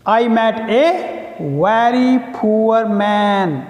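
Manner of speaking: slow, word-by-word reading of the example; a teacher's slow dictation voice. Shown as a demonstration. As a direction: spell it out slowly for the class to write.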